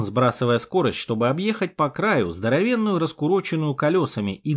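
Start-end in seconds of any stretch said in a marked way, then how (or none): none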